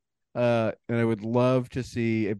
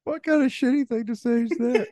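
mock outrage; 11 semitones higher, 3 dB louder than usual. Mock offense at comment.

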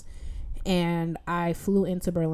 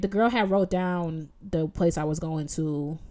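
ordinary talk, nothing marked

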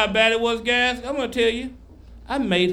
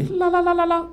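high-pitched "la-la-la" warble; hammed-up falsetto babble of ecstasy; mock pleasure trill; 11 semitones higher than usual. Acts out his reaction to sex.

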